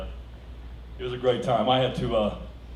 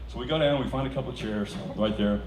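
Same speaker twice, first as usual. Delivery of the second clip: ordinary delivery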